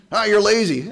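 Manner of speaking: hammed-up spouse insult; mock insult. Preacher imitating a husband insulting his wife, mock jab.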